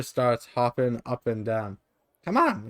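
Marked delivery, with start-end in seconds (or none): 2.2-2.7 high, pushy little grumble; impatient creature. Acted. Mini mite urging the traveler on, impatient and squeaky. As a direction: hurry him along, tiny and cross. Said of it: "it grumbles impatiently".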